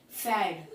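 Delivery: फैड said with no P, starting straight on F; silent P. Shown as a demonstration. Teacher showing students फैड with a silent P. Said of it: "पी की sound होती है silent".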